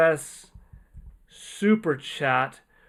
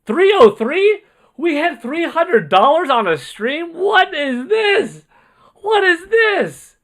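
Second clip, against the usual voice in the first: shocked disbelief; 12 semitones higher, 11 dB louder, slower than usual. Real, not acted. Huge donation total appears.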